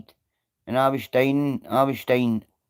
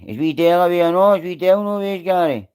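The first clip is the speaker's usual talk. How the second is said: fast and louder, pitch up; blurting the question out quickly; excited; 6 semitones higher, 3 dB louder, faster than usual. Real, not acted. Asking Gary about Dale, fast and excited.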